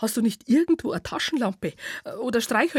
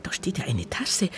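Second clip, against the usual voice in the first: hushed whisper to himself; 4 semitones lower, 4 dB quieter than usual. Acted. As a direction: breathe it out softly. Whispered discovery in dark.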